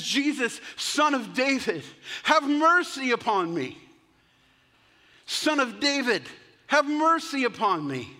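high, loud beggar calling out; pleading cry. Acted. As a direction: cry out for mercy over a crowd. Blind beggar calling out to Jesus.

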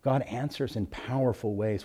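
usual speaking voice

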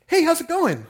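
high, loud, laughing hello; loud mock greeting. Pastor posing as a mannequin greets the shoppers, loud and laughing. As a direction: burst out a cheery greeting.

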